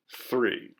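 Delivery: ordinary delivery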